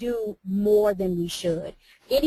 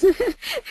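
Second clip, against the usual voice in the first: high laugh; 6 dB louder than usual. Real, not acted. Laughing about credentials remark.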